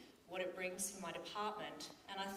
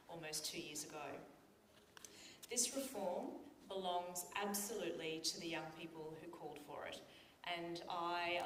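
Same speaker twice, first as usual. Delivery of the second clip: normal talking voice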